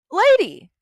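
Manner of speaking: loud, high, indignant address; exasperated outburst. Retold or imitated. Fed-up employee confronting her manager, exasperated outburst.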